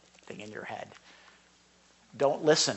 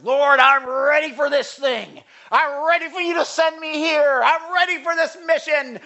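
1 eager, hammed-up prayer; 11 semitones higher, 9 dB louder than usual. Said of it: "sometimes I'm like".

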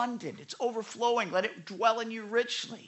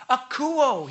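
higher, louder, punched AKOUO; emphatic call; 6 semitones higher, 8 dB louder than usual. Retold or imitated. Preacher calling a crowd to listen, emphatic call. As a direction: call the word out like a command. Exclaims the Greek command to listen.

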